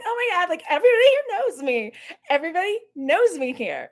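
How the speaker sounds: high-pitched, hammed-up gushing; excited YouTuber. YouTuber bouncing to the next group, mock excited boasting. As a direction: imitate a giddy brag about being known. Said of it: "she was talking just like that".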